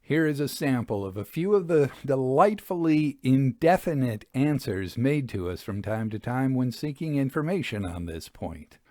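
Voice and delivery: usual speaking voice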